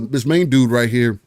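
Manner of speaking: ordinary talk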